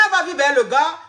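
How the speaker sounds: normal talking voice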